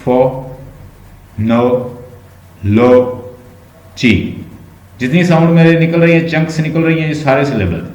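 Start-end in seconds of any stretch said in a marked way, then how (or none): 0.0-4.4 phonology drawn out very slowly with long gaps; syllable by syllable. Shown as a demonstration. Teacher asks students how many, slowly separating syllables. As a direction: say phonology one syllable at a time, pausing after each. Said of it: "तो कितने आप बताएं इसके?".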